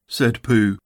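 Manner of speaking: ordinary delivery, nothing marked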